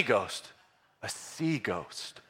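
hushed ghost-story tone; spooky hush. Acted. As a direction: drop low and draw it out like a campfire tale. Spooky build-up about sea ghosts.

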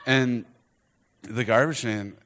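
usual speaking voice

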